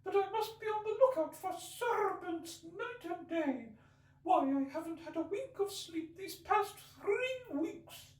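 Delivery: very high, slow, fretful bird voice; lamenting pigeon. Acted. Pigeon ignoring Alice, fretful and aggrieved. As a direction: complain on and on like a fussy bird.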